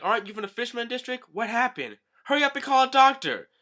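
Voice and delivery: high-pitched alarmed townswoman; hammed-up high panic; woman's cry. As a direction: call out urgently for help. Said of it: "we hear a woman's cry".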